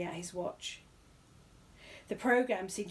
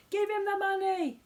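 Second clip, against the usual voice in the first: much higher, louder shout from the crowd; crying out; 13 semitones higher, 6 dB louder than usual. A narrator's reading. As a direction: demand it from the back rows. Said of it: "cried somebody else".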